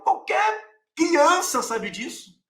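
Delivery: mocking, high-pitched outrage; exasperated high shout. Real, not acted. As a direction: drag it out, scoffing at the doubters. Exasperated rebuke, repeated.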